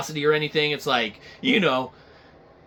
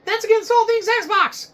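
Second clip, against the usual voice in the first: high, loud fan protest; mock outraged fan; 5 dB louder than usual. Fan protesting loudly in a high squeal. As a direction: defend the brand in a high squeal.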